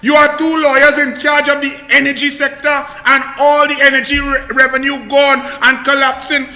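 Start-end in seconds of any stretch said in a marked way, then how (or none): none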